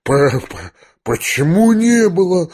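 stuttered start into a high question; stammering bewilderment. A narrator's reading. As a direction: stumble on the first sound, taken aback. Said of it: "пробормотал генерал". (растерянно, заикаясь)

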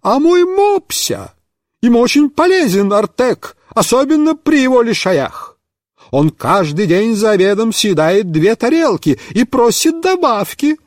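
high, fussy doting voice; doting neighbour, high and fussy. A narrator's reading. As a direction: gush about the pug. Neighbour defending her pug.

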